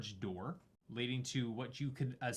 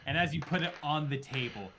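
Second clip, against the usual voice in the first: ordinary talk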